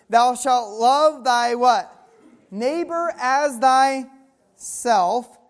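deliberate verse read, pausing for the crowd; loud, measured pulpit reading; slow scripture reading. Acted. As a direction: stretch the words and wait for them to answer.

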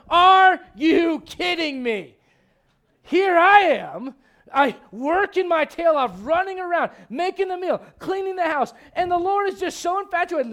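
loud, high, slow outraged complaint; indignant shouting rant; jealous rant. Retold or imitated. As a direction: sister fed up with doing all the work. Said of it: "I can see Martha going".

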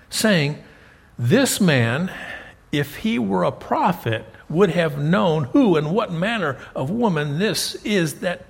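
projected, emphatic verse reading; declaimed Bible verse with lifted pitch; scripture reading. Acted. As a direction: read it out deliberately, punching key words. Reads the scripture account aloud.

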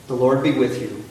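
loud, measured call to the congregation; liturgical call. Real, not acted. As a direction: proclaim it to the hall.

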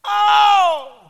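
loud, high yowl of pain; mock pain howl. Boy feeling his foot snap, hammed-up howl. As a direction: imitate a kid howling at a sudden injury. Boy's foot snaps on landing.

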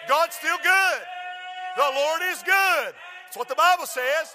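rising pulpit fervor; preaching at full force. Acted. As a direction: drive it home to the congregation. Preacher shouting the refrain.

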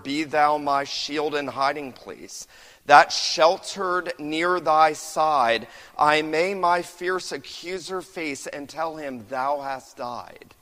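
declaiming the hymn to the congregation; hymn recitation. Acted. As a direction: lift each line of the old hymn slowly. Quoting a hymn verse.

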